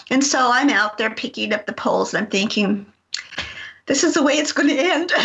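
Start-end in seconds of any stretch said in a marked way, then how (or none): 3.8-5.1 laughing at her own fear. Real, not acted. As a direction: joke through a laugh about dying.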